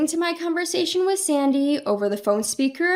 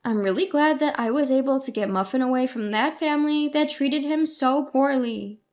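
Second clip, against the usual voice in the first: ordinary delivery, nothing marked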